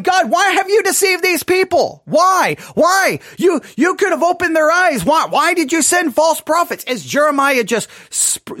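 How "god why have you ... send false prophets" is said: high, louder cry of frustration at God; frustrated crying out. Retold or imitated. Jeremiah crying out to God in frustration, high and loud. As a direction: plead and protest, much higher, shouting the second why. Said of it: "screaming out his frustration towards God".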